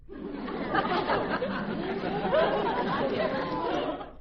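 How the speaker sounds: long, very high cackle trailing down. Acted. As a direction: laugh on and on at yourself. Dan laughs after his farewell.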